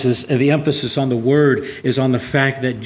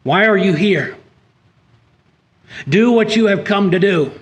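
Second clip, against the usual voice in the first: higher and louder, firm but caring; loving rebuke; 8 semitones higher, 6 dB louder than usual. Retold or imitated. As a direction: rebuke him firmly, out of love. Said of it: "an implication there of a loving rebuke".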